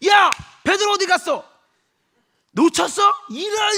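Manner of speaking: raging king's yell; furious Herod. Acted. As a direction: rage at the guards.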